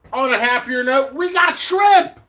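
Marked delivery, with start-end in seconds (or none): 1.1-2.2 high, drawn-out happy holler; excited announcement. Real, not acted. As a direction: belt out the good news, slowly. Announcing a happier note.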